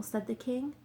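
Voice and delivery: ordinary delivery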